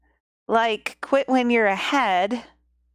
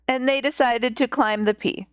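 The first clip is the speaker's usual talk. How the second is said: ordinary delivery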